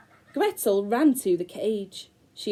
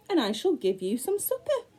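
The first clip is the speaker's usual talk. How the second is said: higher sly crone voice; old woman's voice; 6 semitones higher than usual. Acted. The old woman luring the hungry children, sly high crone voice. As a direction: offer supper sweetly like a scheming old woman.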